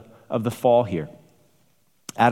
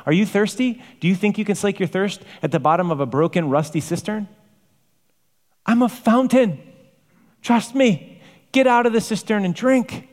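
much higher and louder, urgent appeal; pleading call; 10 semitones higher, 3 dB louder than usual. Acted. Preacher voicing God calling the thirsty to the fountain, loud pleading. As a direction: cry out like God begging his people to drink.

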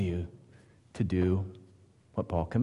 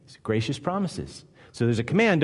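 usual speaking voice

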